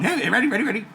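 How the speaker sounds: ordinary talk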